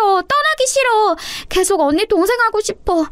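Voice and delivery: put-on high, wailing small-child voice; tearful little sister. A narrator's reading. Little sister begs not to be parted from her sister, tearful. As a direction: plead tearfully like a heartbroken little girl. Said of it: "서윤이가 눈물 콧물을 다 흘리면서 저에게 말했고".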